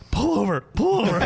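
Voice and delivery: mock panicked yell; frantic demand. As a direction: call out urgently to the driver. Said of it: "he's having a fucking fit".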